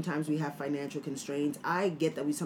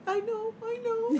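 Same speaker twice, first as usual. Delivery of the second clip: acted elation. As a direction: share the breakthrough in a sky-high, laughing voice. Teacher sharing student's breakthrough joy.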